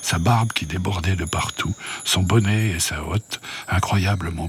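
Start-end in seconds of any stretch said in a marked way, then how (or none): none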